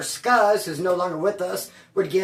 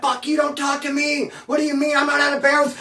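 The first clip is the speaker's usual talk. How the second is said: shouted, higher pitched rage; crazy angry shout; 7 semitones higher than usual. Retold or imitated. Wedo escalating at the cop, angry shout. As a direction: lose it and yell at the cop. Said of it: "Be all crazy".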